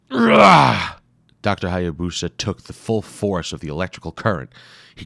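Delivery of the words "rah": loud high roar; rah. A narrator's reading. Narrator voicing the warrior's attack. Sound effect in story.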